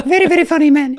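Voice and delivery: put-on very high squeaky Italian accent; comically epic high-pitched voice; Simone Moro. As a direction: imitate an Italian in a high, loud falsetto. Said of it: "Simone has this voice that is just epic".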